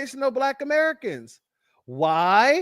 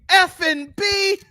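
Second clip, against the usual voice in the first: higher, slow mock Jamaican-style heckle yell; mock heckler scream; 8 semitones higher, slower than usual. Imitating the heckler yelling at [name], hammed-up scream. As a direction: drag it out like a fan screaming from the stands. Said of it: "the dude in the background screamed".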